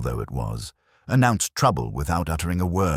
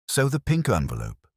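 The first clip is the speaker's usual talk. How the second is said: ordinary delivery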